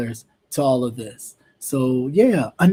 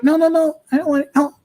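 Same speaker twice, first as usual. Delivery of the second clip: insistent cashier; 9 semitones higher, faster than usual. Retold or imitated. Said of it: "she was like".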